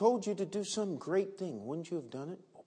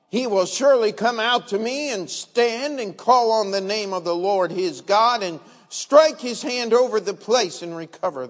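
loud, indignant outburst, pitch raised; angry general; 4 semitones higher, 9 dB louder than usual. Acted. Naaman storming at the prophet's servant, loud furious rant. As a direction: rant as if your pride was insulted. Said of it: "But Naaman was wroth".

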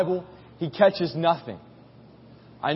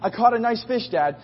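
put-on little boy; 6 semitones higher than usual. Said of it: "being a little boy".

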